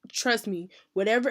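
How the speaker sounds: ordinary delivery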